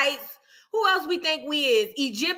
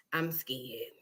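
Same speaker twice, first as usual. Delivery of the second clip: quiet; 12 semitones lower, 15 dB quieter than usual. Real, not acted.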